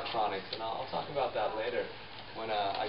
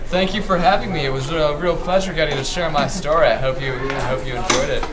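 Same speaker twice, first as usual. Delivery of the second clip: higher, much louder, beaming guest; loud excited thanks; 6 semitones higher, 15 dB louder than usual. Acted. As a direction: gush thanks at full volume. Guest thanks host loudly.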